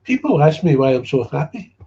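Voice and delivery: mock the cheerful sponsor. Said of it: "There's another one".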